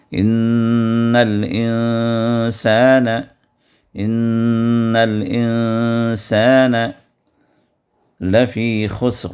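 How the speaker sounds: ان الانسان slow, nasal ن held two beats each; ان الانسان with drawn nasal ن; ghunna and ikhfa. Shown as a demonstration. Teacher drilling ghunna and ikhfa in ان الانسان. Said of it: "دبا کر پڑھیں گے دو حرکت تک".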